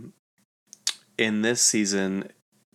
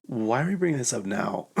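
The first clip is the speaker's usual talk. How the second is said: higher-pitched protesting question; mock incredulous; 4 semitones higher than usual. The girls objecting at the party, mock incredulous. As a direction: imitate the friends' exasperated objection.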